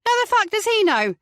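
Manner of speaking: high, loud angry snap; snapped. A narrator's reading. Mother snapping at the detective questioning her son. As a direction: bite her head off.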